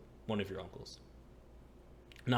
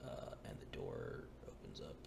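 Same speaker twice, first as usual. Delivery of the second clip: usual speaking voice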